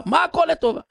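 high, indignant mock outburst; acted angry king. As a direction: throw it back like an exasperated king. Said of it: "המלך התעצבן". (מלך עצבני בקול גבוה, בהצגה)

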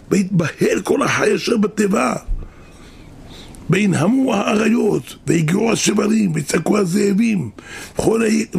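lightly heightened sermon narration of the ark tossing; dramatic flood telling. Acted. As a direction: paint the flood like a sermon climax. Retells flood in chanted drama.

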